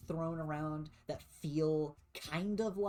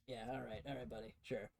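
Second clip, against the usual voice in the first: dismissive; 3 semitones lower, 7 dB quieter, faster than usual. Acted. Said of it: "The other guy's like".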